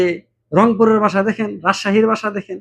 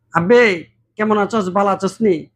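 greeting in Old Dhaka dialect, lightly put on; 3 semitones higher than usual. A man from Old Dhaka greeting a friend, in Old Dhaka dialect. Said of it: "আমার কাছে বলল".

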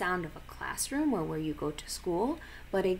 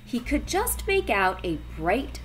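ordinary delivery, nothing marked

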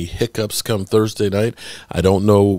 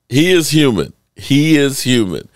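normal talking voice

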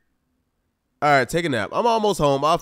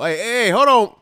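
calling out to stop; 4 semitones higher, 5 dB louder than usual. Real, not acted. Interrupting buddy and wife.

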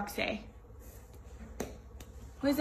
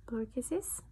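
whisper; 6 dB quieter than usual. Real, not acted. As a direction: whisper it gently. Whispering to toddler.

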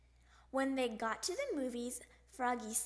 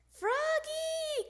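long, high, loud sing-out of a name; calling out; 5 dB louder than usual. A narrator's reading. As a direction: holler for a child across the house. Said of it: "called Dad".

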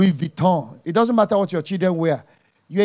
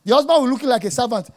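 ordinary talk

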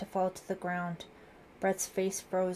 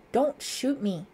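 higher, louder frightened plea; choked out; 5 semitones higher, 6 dB louder, slower than usual. A narrator's reading. Suspect backs away from gun, choked plea. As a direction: beg for life, voice raised. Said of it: "Brett choked out".

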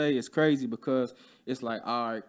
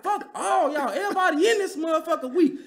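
mock yell; 12 semitones higher, 4 dB louder than usual. Storming out, insulting coworkers.

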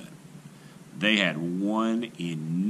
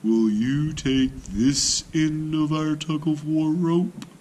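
sly greeting; 4 semitones higher, slower than usual. A narrator's reading. Tortoise greets hippo.

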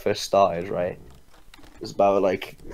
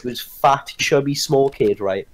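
ordinary talk

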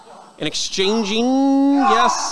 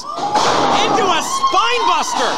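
very high, drawn-out yell; excited shout; 11 semitones higher, slower than usual. Real, not acted. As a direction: scream the big slam. Another near title pin.

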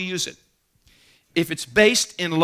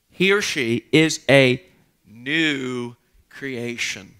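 slow, deliberate reading of scripture with weighty pauses; 3 semitones lower, 3 dB louder, slower than usual. Real, not acted. Pastor reading the verse to the congregation, slow and deliberate. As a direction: draw out each phrase as you read the verse. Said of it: "And I love this".

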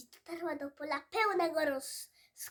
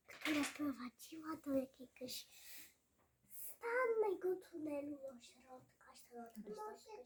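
whisper; 8 dB quieter than usual. A narrator's reading. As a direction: hush it like the dark tunnel. Storyteller hushes mid-tale.

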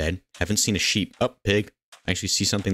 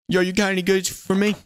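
very high, raised-voice hail; calling out; 13 semitones higher, 4 dB louder than usual. Pretend. Player hails a village trader, high-pitched calling out. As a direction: holler it up high and even.

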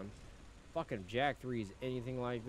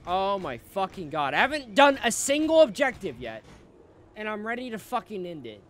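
screaming in real rage at the game; full-throated frustrated gamer rant; furious shout; 10 semitones higher, 14 dB louder than usual. Real, not acted. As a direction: yell it out, loud and fed up. Raging at a game objective.

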